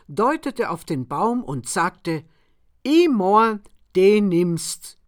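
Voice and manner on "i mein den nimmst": put-on proud old farmer. A narrator's reading. Farmer offers the tree.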